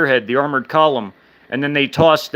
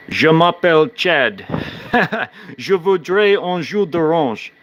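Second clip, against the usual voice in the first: speaking French; 3 semitones higher than usual. Shown as a demonstration. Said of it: "That's my French".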